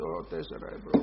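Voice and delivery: breathy gasp at the price, under the breath; shocked whisper. Retold or imitated. Preacher acting a shocked customer, whispered gasp. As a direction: whisper it like the price just hit you.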